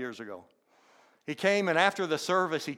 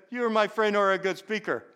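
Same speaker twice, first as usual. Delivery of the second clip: imitated Indian accent, higher and louder; 6 semitones higher, 4 dB louder than usual. Friend's compliment quoted back to the congregation, imitated Indian accent. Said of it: "M J had a distinct accent".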